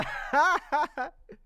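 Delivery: loud, high burst of laughter; hard laughter. Real, not acted. Cracking up at the forced movie accent, hard laughter.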